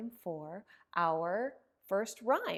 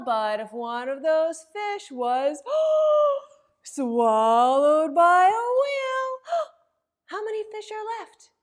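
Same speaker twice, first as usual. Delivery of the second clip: gasping, much higher and louder whale drama; dramatic gasp; 6 semitones higher, 13 dB louder than usual. Acted. Librarian acting shocked as the whale swallows a fish, gasping. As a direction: make the kids jump at the whale.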